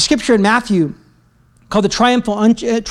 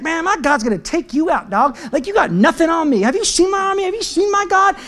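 high-pitched cocky taunting; trash talk; 7 semitones higher than usual. Acted. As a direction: brag at the rival in a raised pitch. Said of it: "just talking trash to Jeroboam".

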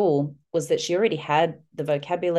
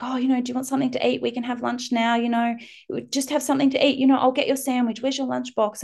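put-on little girls offering help; 8 semitones higher, faster than usual. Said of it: "the little girls were like".

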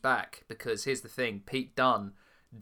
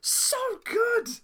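excited; slower than usual. Real, not acted. Raving about the women's match.

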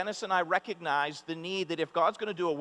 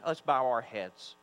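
ordinary talk, nothing marked

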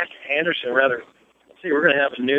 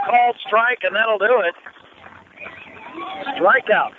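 high, excited strikeout call; 8 semitones higher than usual. Real, not acted. Calls strikeout ending the inning.

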